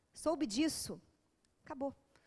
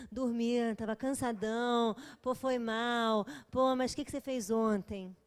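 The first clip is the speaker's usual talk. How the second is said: put-on lazy boyfriend; slower than usual. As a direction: drag it out like a sleepy dude. Boyfriend's message voiced lazily.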